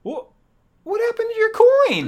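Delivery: high, loud, acted disbelief; incredulous question. Acted quarrel over the coin.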